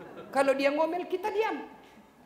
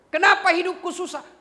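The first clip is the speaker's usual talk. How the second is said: louder, higher lament of a troubled man; complaining believer; 10 semitones higher, 8 dB louder than usual. Retold or imitated. Troubled man complains about his hard life, louder. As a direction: cry out the complaint.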